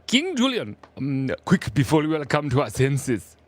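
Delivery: high, lively cartoon-king impression; King Julian. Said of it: "I can do King Julian".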